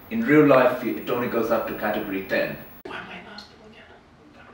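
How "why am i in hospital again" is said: whispering. Real, not acted. Whispered aside during the scene.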